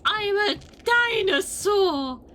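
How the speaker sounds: loud, high, drawn-out declaration; big mock-monster announcement; triumphant dinosaur shout. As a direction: bellow it slowly as a playful dinosaur. Roaring as the dinosaur character.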